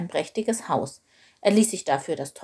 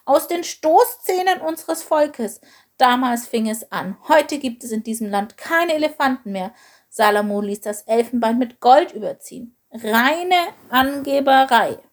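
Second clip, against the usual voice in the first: raised, emphatic voice of mild outrage; indignant; 6 semitones higher, 4 dB louder than usual. A narrator's reading. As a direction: protest with offended scorn, higher pitched. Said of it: "schnaubte Wanda empört".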